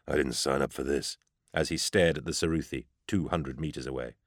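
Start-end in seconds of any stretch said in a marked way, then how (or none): 0.1-1.2 trooper's low, grumbling growl; soft, low soldier's complaint. A narrator's reading. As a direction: growl it under your breath. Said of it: "A trooper near me growled".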